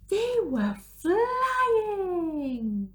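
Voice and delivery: high, slow, drawn-out wonder; amazed. A narrator's reading. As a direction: stretch it out in awe. Snowman flight begins.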